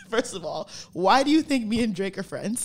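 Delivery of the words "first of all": laughing. Real, not acted. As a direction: burst out laughing. Bursts laughing.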